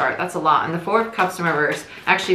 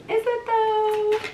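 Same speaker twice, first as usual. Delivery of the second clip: high, drawn-out questioning; slow, high-pitched mock suspicion; playful doubt; slower than usual. Real, not acted. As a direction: stretch it out slowly in a high, teasing voice. Playfully doubts that the cards are finished.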